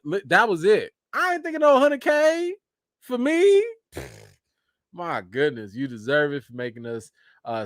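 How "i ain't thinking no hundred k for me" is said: high incredulous. Real, not acted. Incredulous denial.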